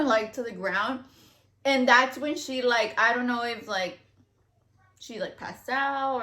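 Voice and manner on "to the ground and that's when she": usual speaking voice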